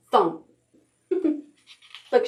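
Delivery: usual speaking voice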